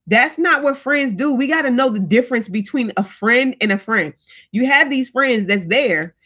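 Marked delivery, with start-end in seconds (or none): none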